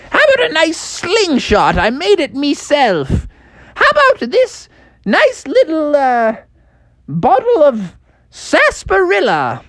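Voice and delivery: put-on high, creaky old miner; old prospector. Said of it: "He's like".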